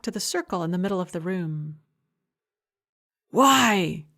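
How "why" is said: sharp, louder angry hiss. A narrator's reading. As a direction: hiss it at him. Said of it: "he hissed".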